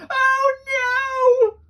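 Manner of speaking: high, loud frightened cry; alarmed cry. Acted. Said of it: "cried Barry".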